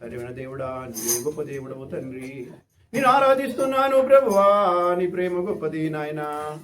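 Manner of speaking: a hint of a flowery prayer; mock effusive prayer. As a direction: overdo the praise like a showy believer. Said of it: "కొంతమంది ఇలా".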